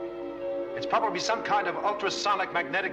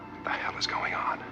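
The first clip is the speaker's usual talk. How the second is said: hushed alarmed whisper. Acted. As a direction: whisper it in shock. Stunned commander under attack.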